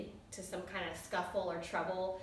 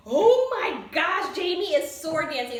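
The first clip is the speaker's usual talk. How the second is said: excited; 9 semitones higher, 14 dB louder, slower than usual. Real, not acted. Excited about the sword dance scene.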